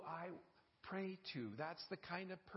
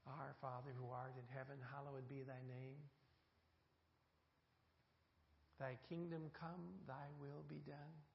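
softer, reverent, unhurried prayer; hushed prayer recitation; 4 semitones lower, 7 dB quieter than usual. Acted.

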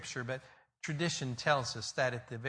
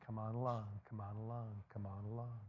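gentle invitation; 2 semitones lower, 6 dB quieter than usual. Acted. God inviting us along after we fail, tender and soft. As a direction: beckon tenderly, almost a hush.

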